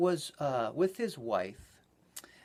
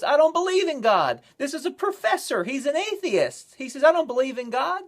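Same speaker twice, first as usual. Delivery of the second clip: defiant disbelief; 11 semitones higher, 9 dB louder than usual. Retold or imitated. Preacher voicing atheist's defiant thought.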